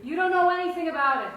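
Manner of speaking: angry outburst. Acted. Mother defending her bar to her son, angry outburst. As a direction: cut in sharply and raise your voice.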